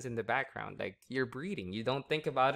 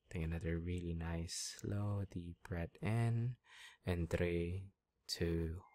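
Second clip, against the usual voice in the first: steady, soothing trance patter; hypnotic induction; 5 semitones lower than usual. Real, not acted. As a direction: ease them down into trance.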